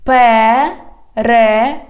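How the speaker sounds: "Перехрестя" slow, in separate syllables. Shown as a demonstration. Teacher has learners repeat, "Перехрестя" slowly by syllables. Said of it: "divide it into syllables".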